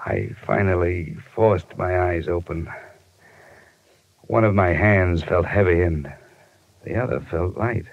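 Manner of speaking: slow, heavy hardboiled narration; weary detective story voice; noir narration. Acted. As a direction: tell it like a hungover private eye. Hardboiled first-person narration.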